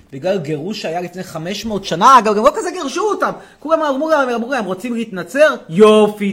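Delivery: shouting, indignant rant at the audience; loud mock rant. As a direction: bellow it like an outraged demagogue. Rants at viewers in loud persona.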